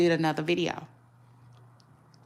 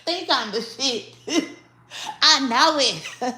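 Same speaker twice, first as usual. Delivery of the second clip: laughing; 7 semitones higher, 3 dB louder than usual. Real, not acted. Laughing at her own quip.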